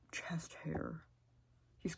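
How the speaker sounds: dreamy whispered aside; swooning whisper about a rugged man. Real, not acted. Fantasizing about Hunter.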